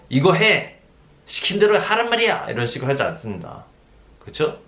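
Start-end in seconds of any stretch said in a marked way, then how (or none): 0.1-2.5 raised pitch, a bossy barked order; mock bossy command. Preacher imitating a pastor ordering the flock, raised bossy pitch. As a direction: play the overbearing leader barking orders. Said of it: "강압적으로".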